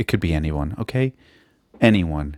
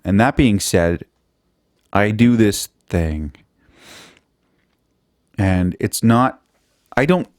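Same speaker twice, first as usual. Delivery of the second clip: ordinary talk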